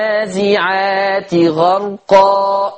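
slow melodic tajweed recitation; Quran recitation. Shown as a demonstration. Teacher recites Surat an-Naziat for students. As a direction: chant each verse slowly.

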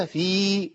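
"في" with a long held ee; elongated ya. Shown as a demonstration. Teacher confirming the madd, "في" drawn out long. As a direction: stretch the vowel of "في". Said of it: "مادية".

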